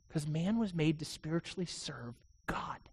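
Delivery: a hushed, intimate reminder; whispered aside. Real, not acted. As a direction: drop to a whisper on this line. Preacher drops to a whisper for emphasis.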